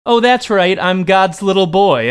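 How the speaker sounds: high, loud mocking quote; imitated gloating, starting high; mock fighters' boast. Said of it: "'cause they go".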